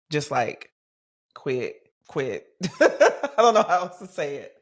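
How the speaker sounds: laughing through the words. Real, not acted. Host laughing at her planner quitting, real laughter. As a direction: chuckle in disbelief as you say it.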